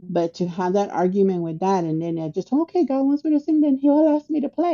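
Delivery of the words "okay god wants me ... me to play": high-pitched, quick inner bargain; retold bargaining. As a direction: rattle off your challenge in a raised pitch. Replays her bargain after the argument.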